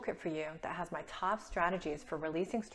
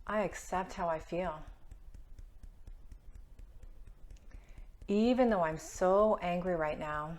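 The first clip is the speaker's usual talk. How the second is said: tapping affirmation. Acted. Coach leading listeners to voice their anger, firm affirmation voice. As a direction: declare each line firmly so they can echo it. Leads tapping statements to repeat.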